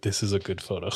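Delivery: low, hushed mock-serious remark; subdued kid imitation. Kid at Office Depot judges his printouts, quietly subdued.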